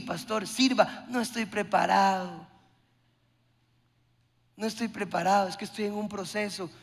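mock a believer dodging service; whiny excuses. Preacher mocking a believer who tells the pastor he is not ready, whiny and soft.

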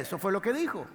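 usual speaking voice

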